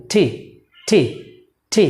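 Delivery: টি with an aspirated t, like ঠ; correct pronunciation. Shown as a demonstration. Teacher modelling the correct letter name, aspirated t. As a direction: push a puff of air out on টি. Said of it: "একটা ধাক্কা দিবেন".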